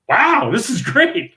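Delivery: high, drawn-out wow of delight; slow, high-pitched amazement, acted out; retold delight. Retells delight at near jackpot.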